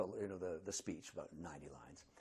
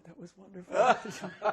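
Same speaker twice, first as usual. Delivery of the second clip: delighted laugh; 6 semitones higher, 9 dB louder, slower than usual. Retold or imitated. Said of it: "And she goes".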